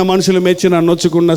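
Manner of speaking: ordinary talk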